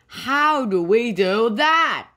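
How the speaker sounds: loud, high narrated yell; storybook yell. A narrator's reading. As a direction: yell it slowly in disbelief. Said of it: "yelled Biff".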